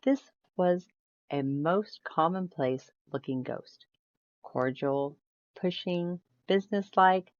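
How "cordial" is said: ordinary delivery, nothing marked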